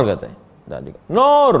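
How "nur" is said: high, louder call of the name; mother calling out. Retold or imitated. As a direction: shout the daughter's name from another room.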